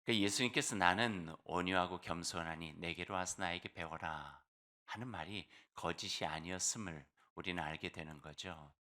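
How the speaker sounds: ordinary delivery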